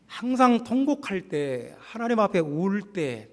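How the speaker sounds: high-pitched, impassioned sermon build; emphatic preaching. Real, not acted. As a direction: drive each phrase home to the congregation. Preaches toward a climax.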